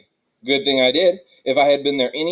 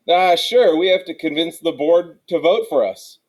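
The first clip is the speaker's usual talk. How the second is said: higher, louder, quick-to-cover answer; pupil caught out; 5 semitones higher, 3 dB louder than usual. A narrator's reading. Pupil answering the teacher after daydreaming, raised caught-out voice. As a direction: cover up for not listening.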